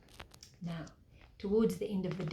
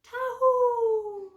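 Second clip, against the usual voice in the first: high, loud ringing call to the sheep; shepherd's call; 14 semitones higher, 7 dB louder than usual. Acted. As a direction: call the flock from far off, high and bright. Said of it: "So when the shepherd goes".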